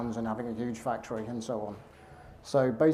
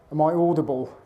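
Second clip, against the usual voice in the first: talking sideways from the mouth corner; 2 semitones higher, 5 dB louder than usual. Real, not acted. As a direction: aim the words down into the lapel mic from the mouth corner. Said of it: "try and speak out the corner of my mouth".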